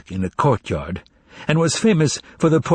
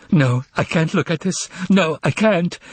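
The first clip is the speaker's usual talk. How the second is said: crying out; 7 semitones higher than usual. A narrator's reading. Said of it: "cried Anna Richardovna".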